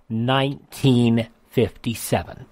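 ordinary talk

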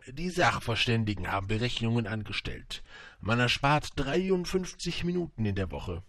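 put-on salesman voice, rising in pitch; storybook merchant quoting the experts; merchant making his pitch. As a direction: sell the figures with pompous emphasis. Merchant sells his pills.

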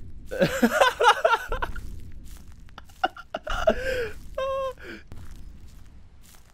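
long high laughing fit trailing into a sigh; real breathless cackle; helpless laughter. Real, not acted. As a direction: crack up at your own scream. Laughs at own scream.

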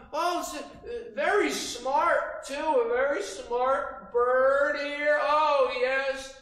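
high, loud, laughing fox flattery; sneaky compliment. Shown as a demonstration. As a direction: gush over the bird with sly delight. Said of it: "What would you say? ... sneaky compliment".

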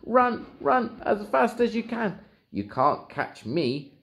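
high cheeky boasting voice; gingerbread man voice. Acted. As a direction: boast and taunt in a tiny voice. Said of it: "said the gingerbread man".

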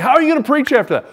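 high, loud, rapid exclamation; fast, high-pitched mock dismay; incredulous question. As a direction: blurt it out in disbelief.

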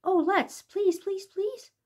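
high, rising pleading please; begging child. Acted. Children begging teacher for candy, high pleading child voice.

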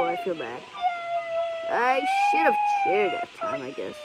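high sad voice. Pretend. Cream feels bad.

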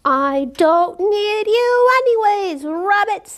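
a fussy wolf boy's mild pretend roar; a hint of a grumpy roar. A narrator's reading. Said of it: "he roared".

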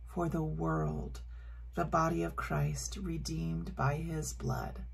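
soft, reverent liturgical prayer. Acted. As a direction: pray the words gently and solemnly. Prays the communion liturgy.

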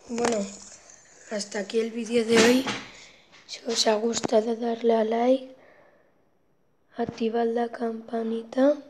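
loud, energetic YouTuber sign-off; loud outro. Acted. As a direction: call out to the viewers with energy.

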